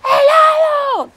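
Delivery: put-on very high, loud, stretched toddler scream; toddler yelling. Daughter screaming at her mother for ice cream. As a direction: wail it like a furious three-year-old. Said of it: "Entonces la niña dice".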